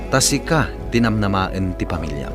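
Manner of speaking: usual speaking voice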